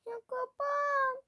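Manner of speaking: very high, loud, slow drawn-out plea; annoyed wail. Real, not acted. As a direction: whine loudly at something refusing to close. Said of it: "Sinirlenmeye başladım".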